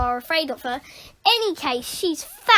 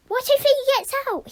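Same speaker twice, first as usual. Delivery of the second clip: hiss; 10 semitones higher, much faster than usual. Acted. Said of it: "hissed Eugene".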